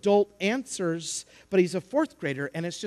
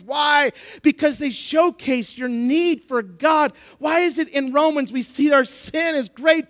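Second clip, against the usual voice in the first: raised, higher-pitched sermon delivery; impassioned preaching; 7 semitones higher, 6 dB louder than usual. Acted. Preacher to congregation on being made for God, impassioned. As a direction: drive each phrase home from the pulpit.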